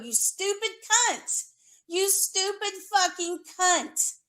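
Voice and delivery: screaming the insult at her ex-friend; furious yell. Real, not acted. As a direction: spit the insult out in real anger.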